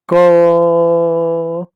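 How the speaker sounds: "क" held long so the a vowel rings; drawn-out letter sound. Shown as a demonstration. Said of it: "मेरे साथ बोलो".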